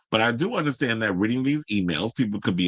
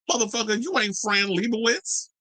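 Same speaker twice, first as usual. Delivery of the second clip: much higher, outraged exclamation; exasperated outburst; 10 semitones higher than usual. Real, not acted. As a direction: blow up in disbelief at him. Ranting at pricey photographer.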